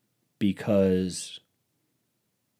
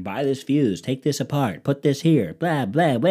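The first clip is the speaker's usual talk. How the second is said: higher, sing-song list of chores; mocking recitation; 5 semitones higher, 3 dB louder than usual. Reciting the online dryer fixes, mockingly. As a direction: rattle off instructions dismissively, pitched up.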